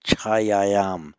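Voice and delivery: chayayam with doubled ya, said loud; correct form. Shown as a demonstration. Teacher modelling the correct Sanskrit form. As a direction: lay out chayayam syllable by syllable. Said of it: "not gajasya chaya".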